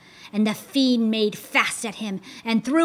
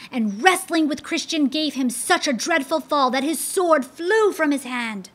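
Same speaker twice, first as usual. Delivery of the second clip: ordinary delivery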